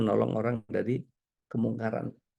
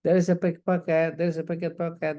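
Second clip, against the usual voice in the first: higher-pitched mock warning call; imitated repeated shout; 5 semitones higher than usual. Imitating woman repeatedly warning crowds of pickpockets, pitched-up mock shout. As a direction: chant it over and over, pitched up. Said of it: "dia ulang-ulang teriak itu".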